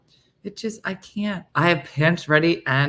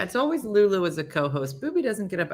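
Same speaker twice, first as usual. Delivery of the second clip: ordinary delivery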